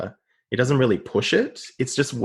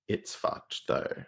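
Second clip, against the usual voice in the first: quiet; 5 semitones lower, 10 dB quieter, slower than usual. Real, not acted. Hushed aside.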